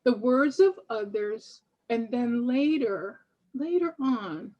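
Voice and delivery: measured, emphatic reading of a written tribute; deliberate recital with punched key words and pauses; formal reading. Acted. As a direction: read it like a spoken-word address, phrase by phrase. Reads own prepared written piece.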